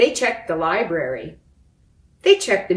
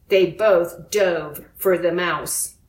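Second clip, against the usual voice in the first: usual speaking voice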